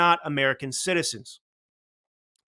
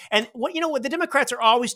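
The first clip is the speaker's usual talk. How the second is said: high-pitched outburst; 10 semitones higher, 3 dB louder than usual. Real, not acted. Sudden outburst.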